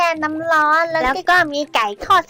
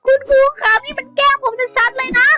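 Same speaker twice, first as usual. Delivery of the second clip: whiny protest; 7 semitones higher than usual. Acted. Skeleton crying out to the teacher that he's being picked on. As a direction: wail at the teacher that it's unfair.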